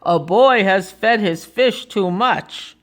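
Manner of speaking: slow policeman's report. Acted. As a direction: report it slowly as the officer. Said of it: "he said".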